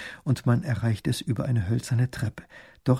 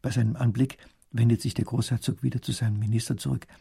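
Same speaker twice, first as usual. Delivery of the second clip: usual speaking voice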